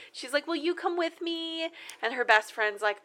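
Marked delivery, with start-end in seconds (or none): 0.5-1.7 high, soft pleading voice; mock pleading. Girl asking her friend to come along, mock pleading. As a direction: imitate a friend sweetly begging for company.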